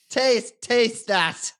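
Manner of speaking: put-on a suspicious farmer in an Irish-sounding accent, breaking into laughter. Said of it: "I've got the accent".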